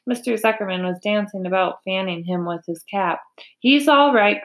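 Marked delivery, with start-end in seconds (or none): 3.6-4.4 higher, louder call; raised-voice protest in a story reading; crying out. A narrator's reading. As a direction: call out over a fuss. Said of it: "cried Zuckerman".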